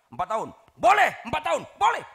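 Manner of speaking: loud, high eager shout; retold shout. Preacher shouting yes to a pastor's question, loud eager shout. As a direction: shout the permission out. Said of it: "Langsung saya teriak".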